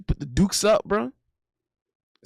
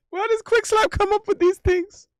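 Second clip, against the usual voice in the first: high-pitched incredulous question; amused disbelief; 12 semitones higher than usual. Real, not acted. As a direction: squeal it out, baffled and tickled. Incredulous at a chat message.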